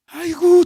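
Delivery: high mock groan of despair; a loud sighing aigo at the husband; mock wail. Wife groaning about her husband, a high mock wail. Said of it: "아내들도 마찬가지야".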